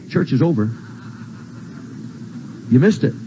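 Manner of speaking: ordinary talk, nothing marked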